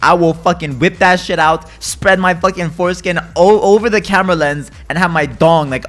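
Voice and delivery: loud, raging threats at the game; real gamer rage, shouting at the stream; furious yelling. Real, not acted. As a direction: blow up at losing, full volume. Raging over losing ranked rank.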